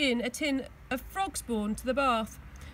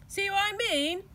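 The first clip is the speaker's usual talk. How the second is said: grinning; 9 semitones higher than usual. A narrator's reading. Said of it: "he grinned".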